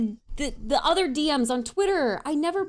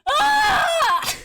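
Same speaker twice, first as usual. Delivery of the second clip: loud, high drawn-out cry; high wail; 13 semitones higher, 7 dB louder than usual. Real, not acted. Overcome by tears of laughter, high wail.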